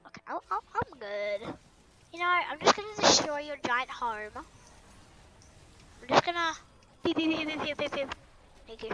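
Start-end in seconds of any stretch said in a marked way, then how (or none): 4.4-8.1 slowly drawn-out robotic beeps; mouth beeping noises. Pretend. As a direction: beep like a machine. Imitating weapon beeping.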